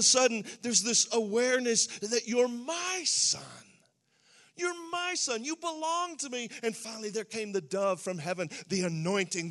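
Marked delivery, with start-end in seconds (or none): 4.5-6.5 much higher, ringing; fervent proclamation. Retold or imitated. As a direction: lift it up like a revelation. Father's acceptance proclaimed.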